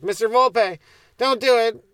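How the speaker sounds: high, loud urgent plea from a classmate; girl's warning. Retold or imitated. Classmate warning the teacher, loud and high. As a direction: call out to stop him drinking.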